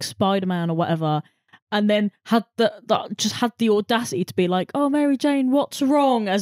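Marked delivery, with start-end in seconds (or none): 4.7-6.3 higher-pitched, imitated innocent concern; mock-innocent boyfriend asking after her. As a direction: play clueless concern, mockingly. Mocking Peter's clueless concern.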